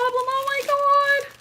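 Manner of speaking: very high, slow squeal of delight. Real, not acted. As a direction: stretch it out in a gasp of joy. Gushing over a gift.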